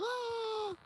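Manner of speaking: very high put-on yelp; a short shrill fake cry of a child; mock child's cry. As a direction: imitate a kid crying out. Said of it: "And they're like".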